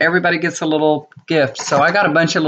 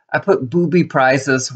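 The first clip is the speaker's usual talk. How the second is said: ordinary talk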